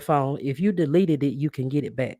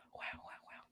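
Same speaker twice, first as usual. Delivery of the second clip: awed whisper; 27 dB quieter than usual. Real, not acted. Overwhelmed by viewers' support.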